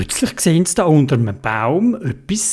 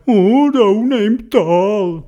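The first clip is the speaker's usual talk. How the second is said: higher, slow wailing bear voice; moaning little bear; 5 semitones higher, slower than usual. Acted. Little bear tells where mother is, slow plaintive moan. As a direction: draw it out like a sorry little bear. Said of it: "Und der hat gesagt".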